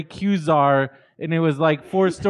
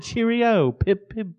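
higher, sing-out amateur British; mock British greeting; 5 semitones higher, slower than usual. Guest defends his British accent attempt, mock British. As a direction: chirp it in put-on British. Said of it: "I said".

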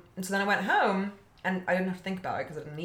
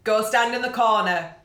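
louder stern teacher ordering; 3 semitones higher, 6 dB louder than usual. Retold or imitated. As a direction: send the boy to the corner. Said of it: "they'll be like".

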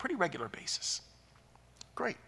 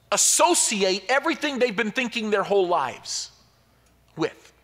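louder, higher preacher push, building fervour; preaching at full force; 7 semitones higher, 9 dB louder than usual. Acted. Pastor preaching to the congregation about first sight, at full force. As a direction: drive the point home from the pulpit.

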